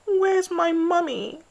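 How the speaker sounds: put-on high, slow little-girl wail. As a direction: wail for your mummy. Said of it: "she wailed".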